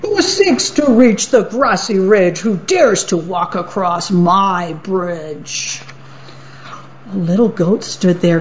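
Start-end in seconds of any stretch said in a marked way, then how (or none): 0.0-5.8 troll challenge. Acted. Troll challenges whoever crosses his bridge, calling out. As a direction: boom the challenge then drag it out.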